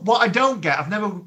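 usual speaking voice

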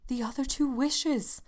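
ordinary delivery

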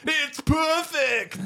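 high, drawn-out cartoon cry; quoted cartoon critic. The guy admiring the sculpture, hammed-up high cry. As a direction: stretch it out high in mock awe. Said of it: "and he goes".

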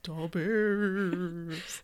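gruff Chicago Da Bears voice; Chicago accent. Retold or imitated. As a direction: ghost talking Chicago. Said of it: "it's like".

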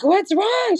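high-pitched worried question, imitating mom; nervous mother. Mom asks her child on the phone, nervous and high. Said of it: "being nervous".

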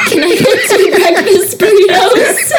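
high voice breaking into laughter; laughing through it. Retold or imitated. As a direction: blurt the order while cracking up. Retelling awkward order, laughing.

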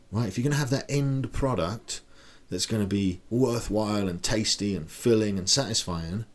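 ordinary talk

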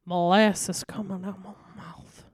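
slow, syrupy mock Southern drawl; Daniel Craig's Southern accent. Podcaster mocking an actor's accent, slow molasses Southern drawl. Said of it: "his accent was terrible".

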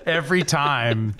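giggling through the words; can't stop laughing; laughing while talking. Real, not acted. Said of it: "I was trying not to laugh".

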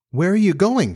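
high, mildly worried question; misgiving. A narrator's reading. Wendy sees Peter leaving, asking with misgiving. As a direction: ask it high, with a touch of worry. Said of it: "she cried with misgiving".

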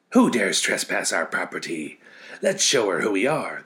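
loud menacing whirlwind voice. Acted. Whirlwinds spotting the girl, loud and menacing. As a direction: threaten the trespasser. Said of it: "they screamed".

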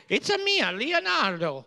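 high cartoon Italian accent; chirpy plumber Italian accent; Mario voice. Mario-style Italian accent joke.

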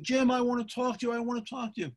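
high-pitched mock scream, not full volume; retold coach screaming. Screaming coach wants a talk with the referee, high mock yell. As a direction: imitate a high-strung coach demanding attention. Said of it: "the coach is screaming".

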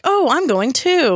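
high, hammed-up eager announcement; Niles. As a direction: imitate someone suddenly inviting himself along, high. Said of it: "So Niles is like".

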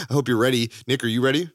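normal talking voice

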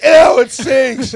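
very loud, high drawn-out yell; mock-revolted holler; disgusted shout. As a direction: recoil from a stench at full volume. Acts out disgusted onlookers yelling.

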